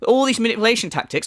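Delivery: usual speaking voice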